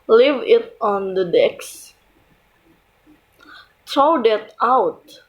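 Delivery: slow, pitch falling on "desk" and "out"; falling intonation on DESK and OUT. Shown as a demonstration. Teacher reads example comments to learners, falling intonation on "desk" and "out". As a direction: read slowly, letting "desk" and "out" drop at the end. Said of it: "the pitch of the voice falls at the end".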